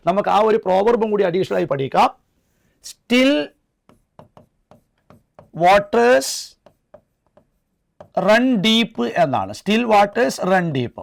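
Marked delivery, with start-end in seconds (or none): 2.9-9.0 very slow, long pauses between the words; word-by-word dictation. Shown as a demonstration. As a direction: dictate it one word at a time. (ഓരോ വാക്കായി നിർത്തി നിർത്തി)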